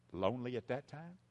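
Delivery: ordinary talk, nothing marked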